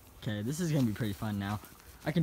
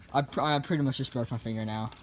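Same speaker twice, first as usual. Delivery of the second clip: ordinary delivery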